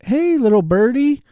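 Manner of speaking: put-on high cooing baby talk to a small bird; high sing-song coo. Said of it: "I like".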